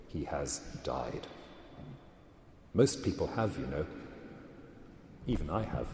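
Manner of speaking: gentle hush with a hidden smile; very quiet. A narrator's reading. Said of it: "said the lion in a very quiet voice".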